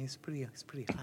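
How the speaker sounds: fast whispered warning; waiter's warning. Retold or imitated. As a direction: hush it like a secret. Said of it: "He's like".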